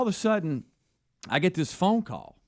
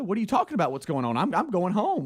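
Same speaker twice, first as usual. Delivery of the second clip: retold panic, pitched up; higher, rattled questions; freaking out; 6 semitones higher, faster than usual. Speaker retells panicking to the engineer on the phone, pitched up. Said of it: "I'm freaking out now".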